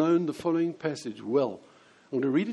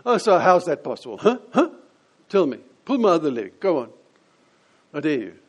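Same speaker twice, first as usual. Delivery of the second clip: loud scoffing challenge; mock dismissive; 8 dB louder than usual. Preacher voicing the supposedly dismissive Nicodemus, scornful and loud. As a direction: scoff it out with a mocking "huh?". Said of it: "his tone of voice probably was".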